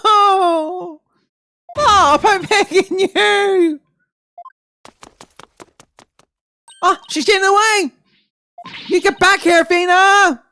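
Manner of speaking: panicked shout. Acted. Athena fleeing the magic trainer, begging and shouting high. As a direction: scream as you flee the bucket trick. Assistant flees water tank trick.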